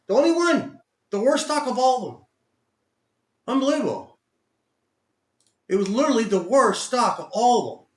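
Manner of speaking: loud, high exasperated ranting. Real, not acted. Trader venting about the worst oil stock, loud and high. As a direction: vent about the stock, loudly.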